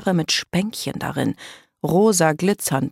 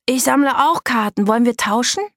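higher, eager offer to swap; excited kid proposing a trade; enthusiastic; 8 semitones higher, 4 dB louder than usual. A narrator's reading. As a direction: ask keenly, voice lifted. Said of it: "fragte Daka begeistert".

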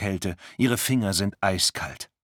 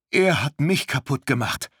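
higher, pained outburst; desperate retort; 7 semitones higher, 3 dB louder than usual. Acted. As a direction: fling it back in hurt. Luisa answers stranger desperately.

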